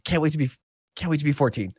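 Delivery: put-on high, eager young voice; thirteen-year-old kid. Kid answering an adult about age, high young voice. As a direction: play a kid impatient to grow up.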